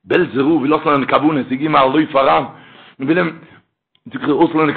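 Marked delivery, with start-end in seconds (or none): none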